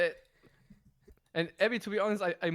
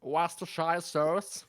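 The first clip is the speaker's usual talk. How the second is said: slow, drawn-out American accent; slower than usual. Shown as a demonstration. Guest showing a streamer their best American accent, exaggerated. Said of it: "Can you do your best American accent?".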